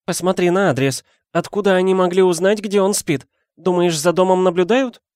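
high, anxious questioning; Aunt Petunia trembling. A narrator's reading. Wife asking husband if house is watched, high anxious voice. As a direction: worry aloud, ending on a rising question. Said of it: "дрожащим голосом".

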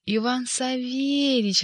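high, slow, delighted rattle; rattling Koroviev voice. A narrator's reading. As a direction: cry out in joyful greeting. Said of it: "радостно вскричала трубка".

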